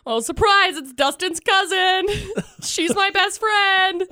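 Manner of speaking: spring the surprise, laughing; laughing surprise reveal. Retold or imitated. Revealing to the neighbor that it's Dustin's cousin, laughing. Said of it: "I'm like".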